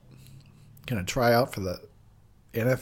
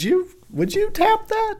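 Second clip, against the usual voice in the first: octaves-up incredulous question; 4 dB louder than usual. Real, not acted. Asking the viewers about this woman, incredulous squeak. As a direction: voice shooting up very high. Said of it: "My voice had to go up a few octaves".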